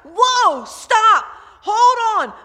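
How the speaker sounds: loud, high frantic yells; panicked shouting. Retold or imitated. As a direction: scream at the horse to stop. Panicked rider near the cliff.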